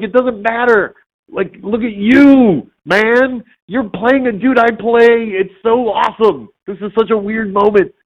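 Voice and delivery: high-pitched, drawn-out gushing; freaking out. Retold or imitated. Actor raving at the man playing his character, freaking out. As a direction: rave in sustained high-pitched amazement. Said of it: "totally freaking out about this guy".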